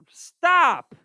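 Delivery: loud, high cut-off; sharp shout. Real, not acted. Teacher cutting off a student's wrong answer, shouted. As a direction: shout to halt someone mid-answer.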